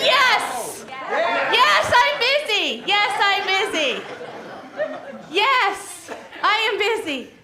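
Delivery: high, loud, hammed-up busy chant; mock frantic busy. Song leader finishing the game, playing overwhelmed. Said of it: "Whew, I'm so busy".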